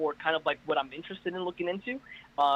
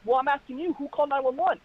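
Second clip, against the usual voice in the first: exasperated asking; 9 semitones higher than usual. Retold or imitated. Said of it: "I'm like".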